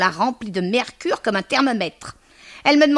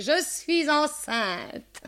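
drawn-out cry; 8 semitones higher, much slower than usual. Acted. Macaré repeating her news to the landladies, drawn-out cry. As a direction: repeat the cry, stretching every word. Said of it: "cria Macaré".

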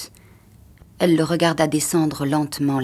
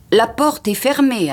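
slow, high, loud announcement; announcing; 7 semitones higher, 5 dB louder than usual. A narrator's reading. Chauffeur reporting back from the door, raised announcing voice. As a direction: call the news out deliberately. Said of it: "annonça-t-il".

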